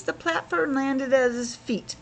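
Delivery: ordinary delivery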